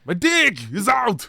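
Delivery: a hint of a sleazy Frenchman, drawn out; lightly voiced French guy. Friend voicing the movie's French guy in a slow put-on voice.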